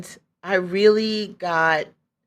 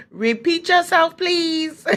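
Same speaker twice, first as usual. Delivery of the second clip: very high, louder, half-laughing call; mock polite request; 12 semitones higher, 5 dB louder, slower than usual. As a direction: call it out high, as if to an unseen speaker. Jokingly answers voice in her head.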